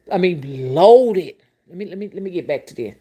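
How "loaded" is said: loaded said very loud and higher; punched emphasis. Real, not acted. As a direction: slam the word loaded. Topping rich, rich.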